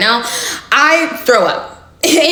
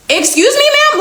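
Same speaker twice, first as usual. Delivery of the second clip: incredulous excuse me; 13 semitones higher than usual. Real, not acted. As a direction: pitch jumps much higher on ma'am in disbelief. Incredulous retort to friend's accusation.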